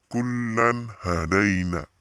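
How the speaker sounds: KULLAN HADAINA drawn out, n distinct; clear recitation. Shown as a demonstration. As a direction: slowly demonstrate Kullan hadaina, unblurred n.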